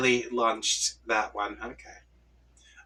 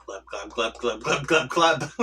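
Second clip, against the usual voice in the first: expressive glubbing. Acted. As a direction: let the glubs swell with feeling. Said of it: "Help me tell without saying glub".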